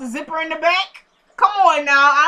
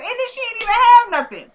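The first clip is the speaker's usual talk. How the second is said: much higher, louder, outraged disbelief; incredulous exclaim; 10 semitones higher, 5 dB louder than usual. Real, not acted. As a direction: squeal it like you can't believe she had nothing. Indignant about Esperanza's empty comeback.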